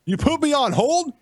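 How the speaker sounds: affronted, incredulous protest; outraged. Real, not acted. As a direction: repeat it in rising indignation. Outraged caller confronts host.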